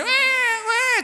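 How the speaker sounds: high-pitched mock bawling; wailing child. Preacher imitating a boy shocked at the socket, high mock wail. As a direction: bawl like a kid who just got zapped.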